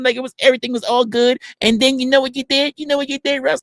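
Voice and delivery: high-pitched imitation of a reminiscing caller; mock eager accuser. Accuser reminding streamer of San Diego, high mock voice.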